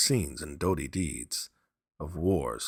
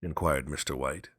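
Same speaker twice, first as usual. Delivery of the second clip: plain reading voice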